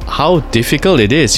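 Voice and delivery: ordinary delivery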